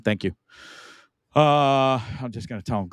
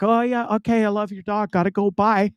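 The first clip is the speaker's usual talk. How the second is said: higher mock brush-off, quick goodbye; dismissive girls; 8 semitones higher than usual. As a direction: imitate the girls blowing him off for the dog. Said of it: "they're just like".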